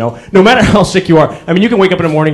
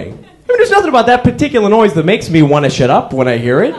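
ordinary delivery

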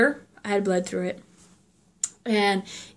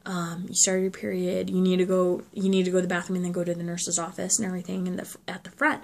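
hushed, soft retelling of a whisper; teacher murmuring discreetly in her ear, soft; retold whisper; 3 semitones lower, 8 dB quieter than usual. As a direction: quietly pass on the teacher's secret. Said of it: "she whispered to me".

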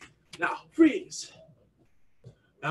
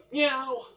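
high-pitched little meow; cat meow; 7 semitones higher than usual. Shown as a demonstration. Coach starting stretch kicks with animal sounds, high meow. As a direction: mew like a cat on the kick. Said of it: "only doing animal sounds".

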